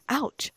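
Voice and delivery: high, loud ouch of pain; sudden pained ouch; sharp yelp of pain. A narrator's reading. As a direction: yelp it like a stubbed foot. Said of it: "Hermione gasped suddenly".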